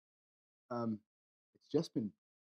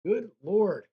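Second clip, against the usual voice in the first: exasperated outburst; 7 semitones higher, 8 dB louder than usual. Real, not acted. Bad luck at the dice table.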